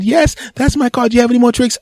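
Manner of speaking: woman on a one-night stand, in a high mock falsetto. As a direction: squeak it like an impressed lady. Woman answering card trick guy.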